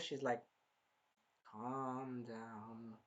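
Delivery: low, slow, hushed "calm down"; acted attempt to keep a temper down; drawn out low and soft; self-soothing. Acts character holding back anger.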